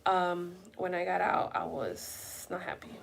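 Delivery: slow, soft, halting mock answer; imitating fiancé's answer. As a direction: drag it out quietly like him. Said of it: "he starts off like this".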